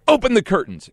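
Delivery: high, loud command to the crew; called order. Retold or imitated. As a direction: call it out across the stage.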